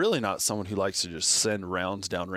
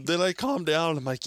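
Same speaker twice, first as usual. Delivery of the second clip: ordinary talk, nothing marked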